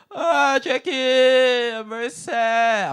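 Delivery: put-on high, slow, drawn-out old auntie lament; godma voice. Comic telling her godma moaning to Jackie, high drawn-out lament. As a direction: stretch out the ah like a mournful auntie.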